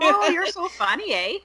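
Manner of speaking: a hint of a Canadian accent, high and teasing; mock Canadian. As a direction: tease them in a jokey Canadian accent.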